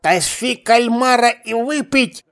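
gruff, lisping dwarf bark; slow lisping fake bass; slushy lisp. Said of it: "басовито, но шепеляво произнёс".

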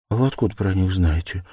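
usual speaking voice

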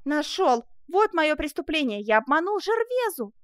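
high, loud eureka cry; triumphant. Acted. Prisoner suddenly finds a crime to confess, triumphant. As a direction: exclaim it in rising triumph.

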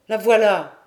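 loud, short exclamation; calling out. A narrator's reading. Harbour master spotting the girl, a loud call. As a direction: call it out to the group. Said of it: "fit le maître de port".

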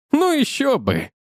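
a friend mocking the lovesick groom with contempt; open contempt. A narrator's reading. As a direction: sneer it with open disdain. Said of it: "с нескрываемым презрением сказал я".

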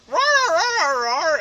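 loud, high imitated roar; barking dog. Imitating a dog heard at adoption, high roaring. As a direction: yap out roars as a kennel dog. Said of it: "like, you hear".

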